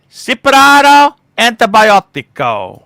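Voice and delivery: slow, loud hammed-up Italian Mexican accent. Shown as a demonstration. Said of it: "That's a very good Italian Mexican accent".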